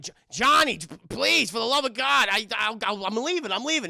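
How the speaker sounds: high, loud stammering plea; panicked pleading. Retold or imitated. Snakes begging Johnny not to shoot. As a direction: beg for your life.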